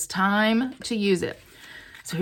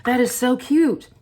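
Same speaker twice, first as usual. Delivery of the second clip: loud, higher gush of delight; 4 semitones higher, 6 dB louder, slower than usual. Real, not acted. Crafter gushing to viewers over her cute bird, excited. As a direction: burst out gushing, emphatic.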